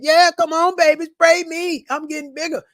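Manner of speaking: high, loud, teasing voice of a fire being fed; mock the spray bottle like a gleeful fire, up high; taunting fire. Fire taunts the debtor spraying it, high teasing voice. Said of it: "Fire said".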